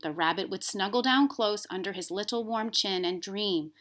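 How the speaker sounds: ordinary delivery, nothing marked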